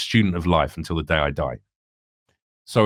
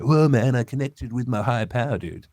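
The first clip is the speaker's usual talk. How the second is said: stoner dude; 3 semitones higher than usual. Retold or imitated. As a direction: ham up a blissed-out tripper. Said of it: "feeling like".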